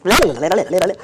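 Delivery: mock garbled rant; angry jabbering. Daughter ranting at mother on the phone, mock-angry. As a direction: babble it like an irate tirade. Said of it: "she was so angry".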